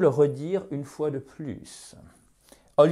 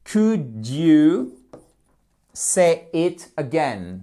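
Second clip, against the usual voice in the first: slow, emphatic 'Could you' then 'say it again' word by word; louder, drawn-out model phrase; slow teaching model; 3 dB louder, much slower than usual. Shown as a demonstration. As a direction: dictate it carefully to learners.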